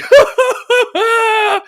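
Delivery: high, drawn-out mock cackle; high-pitched put-on laughter; imitated friend's laugh. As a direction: mimic your buddy's laugh, high and long. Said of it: "laughing your normal laugh".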